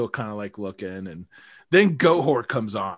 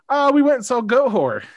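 higher, louder awkward admission; sheepish confession; 12 semitones higher, 7 dB louder than usual. Retold or imitated. Telling his mom about the concert, higher sheepish answer. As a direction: confess it guiltily. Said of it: "I was like".

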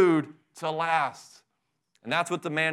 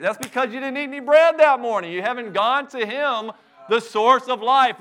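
raised, higher preaching voice; forceful preaching; 6 semitones higher, 5 dB louder than usual. Real, not acted. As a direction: drive it home from the pulpit. Preacher rebukes the congregation.

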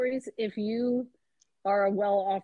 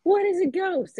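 high, louder startled question; startled maid; 10 semitones higher, 5 dB louder than usual. Retold or imitated. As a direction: play the maid caught off guard. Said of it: "and she's like".

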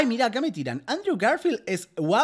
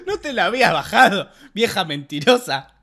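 laughing; 3 semitones higher, 5 dB louder than usual. Real, not acted. Teasing laughter about the wine.

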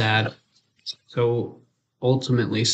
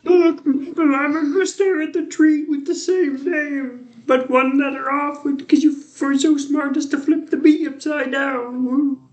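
high, wailing fake cry; mock crying whine; 13 semitones higher than usual. Mock wail at friend.

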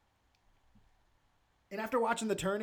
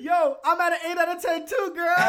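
excited; 12 semitones higher, 10 dB louder than usual. Real, not acted. Agreeing on the rating, thrilled.

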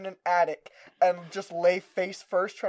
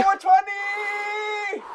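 drawn-out excited shout; 14 semitones higher, much slower than usual. Real, not acted. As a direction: stretch it out in triumph. Realizing it's 4/20.

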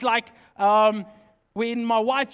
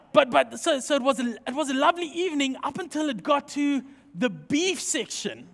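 normal talking voice

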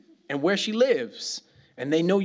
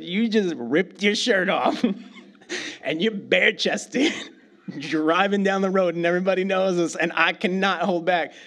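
laughing; 4 semitones higher, 4 dB louder than usual. Retold or imitated. Son answers father, laughing.

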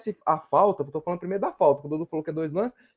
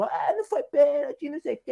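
Samuel Xavier; 6 semitones higher than usual. Retold or imitated. Panelist recalls player protesting last year, mock whining. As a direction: imitate a protesting player, higher but not shouted. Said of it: "que ele ficou lá gritando".

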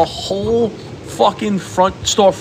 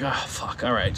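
reluctant mutter; 5 semitones lower, 12 dB quieter, slower than usual. Retold or imitated. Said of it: "I'm like".

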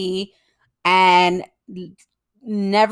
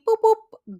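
high beeping noise. Retold or imitated. Imitating brain kicking into overdrive.

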